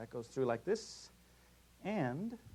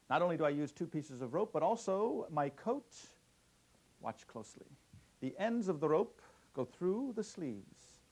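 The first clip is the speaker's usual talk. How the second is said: put-on kids party magician; 3 semitones higher than usual.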